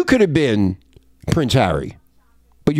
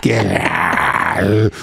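alien voice shout; 7 semitones lower than usual. Acted.

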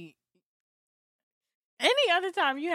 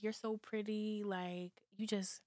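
tender retold praise; 2 semitones lower, 12 dB quieter, slower than usual. Friend retells a lover's praise to her co-hosts, tender and hushed. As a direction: murmur it slowly like a doting lover.

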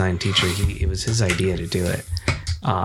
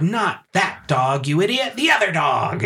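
mock witch scolding; 8 semitones higher than usual. Witch improvising onstage at the wrong dog, high mock scolding. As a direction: scold the monkey like an exasperated witch. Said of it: "she was like".

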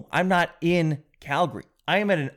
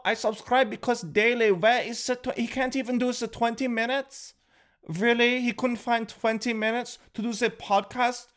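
high, whiny complaining fan; outraged fan voice; 8 semitones higher than usual. Retold or imitated. A made-up fan whining about the missing podcast. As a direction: squeal it like an outraged subscriber.

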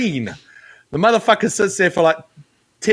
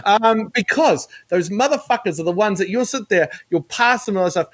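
normal talking voice

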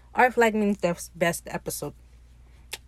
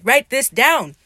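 loud, higher mock-excited command; eager order; 5 semitones higher, 8 dB louder, slower than usual. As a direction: urge it loudly, imitating an eager captain. Retold eager order to scribe.

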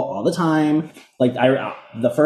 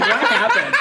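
much higher, laughing what happened; mock baffled; slower than usual. Cohost as a stunned Christina asking what happened, laughing. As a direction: squeak it out in shock. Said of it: "it's like".

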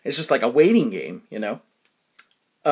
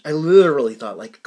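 normal talking voice

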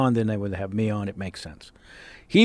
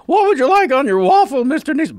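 high, bright chirpy server, much higher; waitress voice; 13 semitones higher, 5 dB louder than usual. Retold or imitated.

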